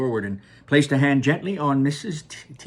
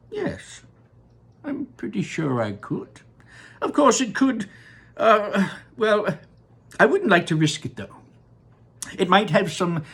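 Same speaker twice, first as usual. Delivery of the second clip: high, dithering candymaker voice; eccentric Wonka; 6 semitones higher than usual. Acted. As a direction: waver between sure and unsure. Voicing Wonka at length.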